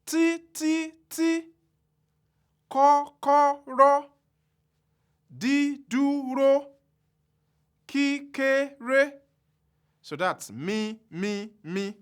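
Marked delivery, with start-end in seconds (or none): 0.1-9.1 kọ́kọ́rọ́, dídúró, kékeré held high and drawn out slowly; every syllable of kọ́kọ́rọ́ level and high, slow; high tone three syllables. Shown as a demonstration. Teacher spelling out trisyllabic high tone, high and slow. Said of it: "I'm going to go over this again".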